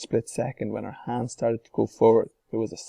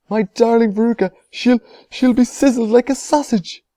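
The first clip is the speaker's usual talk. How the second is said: panicked wail; 13 semitones higher, 10 dB louder than usual. A narrator's reading. Mother wails in panic.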